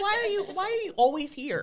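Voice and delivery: high, puzzled sister voice; exasperated sisters. Retold or imitated. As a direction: play the fed-up sisters. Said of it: "and they're like".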